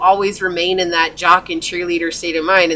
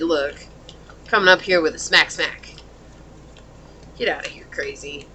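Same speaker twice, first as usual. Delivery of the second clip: low, soft dismissal; 4 semitones lower, 5 dB quieter than usual. Retold or imitated. Reader voicing the client brushing off a pushy suitor, quietly. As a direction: lower and softer, a quiet brush-off. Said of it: "You said".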